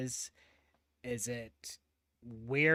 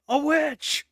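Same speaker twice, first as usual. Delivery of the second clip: high, loud cry of accusation; mock accusing shout; 12 semitones higher, 10 dB louder than usual. Joking as an accuser spotting a woman reading, mock shout.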